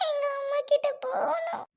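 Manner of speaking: put-on high, slow childlike whimper; like a little child. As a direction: whine for mother like a small child. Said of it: "என்ன சுவாதி குழந்தை மாதிரி?". (சின்ன குழந்தை போல சிணுங்கல்)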